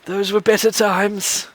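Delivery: put-on high, soft, slow old man; a doddering old man welcoming them; frail old man voice. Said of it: "a frail voice".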